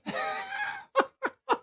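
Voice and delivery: high breathless laughter trailing off; helpless laugh. Real, not acted. Host can't stop laughing at the worst knife, high and breathless. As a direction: laugh until the voice squeaks away.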